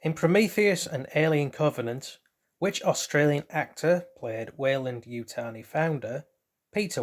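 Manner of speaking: bright quiz-host read, options spaced out; quizmaster reading. Acted. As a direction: read it like a quiz show question. Reads out quiz question and options.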